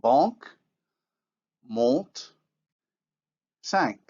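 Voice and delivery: banque, monte, cinq with vowels through the nose; no n before the consonant; French demo words; French nasal vowels. Shown as a demonstration. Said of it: "as in the following words".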